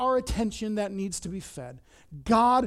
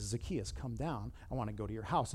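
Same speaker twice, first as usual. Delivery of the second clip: much lower, hushed invitation; tender acceptance; 9 semitones lower, 10 dB quieter than usual. Acted. Jesus coming up to Zacchaeus in the tree, softly accepting. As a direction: drop low and soft, inviting him personally.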